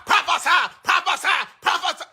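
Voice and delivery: high, loud chant of prophesy; imitating the screaming crowd; mocking crowd yelling. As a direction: shout it like a worked-up congregation. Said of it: "and y'all".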